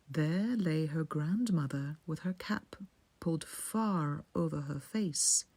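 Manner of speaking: ordinary delivery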